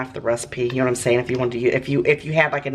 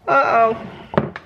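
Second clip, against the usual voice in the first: high playful uh-oh; 9 semitones higher, 4 dB louder than usual. Pretend. Brownie falling apart.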